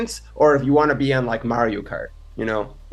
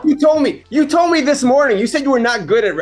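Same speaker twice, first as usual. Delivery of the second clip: high, loud accusing disbelief; incredulous shout; 10 semitones higher, 5 dB louder than usual. Real, not acted. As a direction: call him out, half laughing. GM accuses player of lying.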